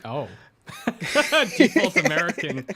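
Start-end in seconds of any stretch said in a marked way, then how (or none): 0.9-2.7 laughing. Real, not acted.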